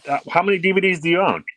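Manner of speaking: incredulous. Real, not acted. Incredulous question about DVDs.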